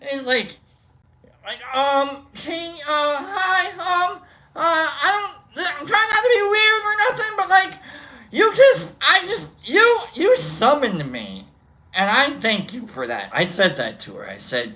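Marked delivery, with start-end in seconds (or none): 2.3-11.5 high fangirl voice on the verge of tears; starstruck fan. Retold or imitated. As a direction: gush at your idol, nervous and teary. Fan nervously addressing singer.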